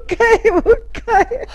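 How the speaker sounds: high giggly voice; fighting back laughter; stifled giggles. Real, not acted. Can't stop laughing during take.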